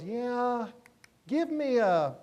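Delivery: imitating a customer stalling as he decides; hesitant customer. Salesman ordering at the McDonald's counter, hesitant.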